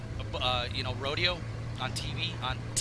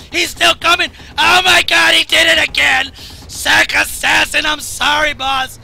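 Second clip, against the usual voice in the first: loud, high, panicked yelling at the game; real gamer panic, yelling apologies to teammates; frantic shouting; 6 semitones higher, 8 dB louder than usual. Real, not acted. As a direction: shout in real alarm as the plane comes back. Frantic shouting at plane attack.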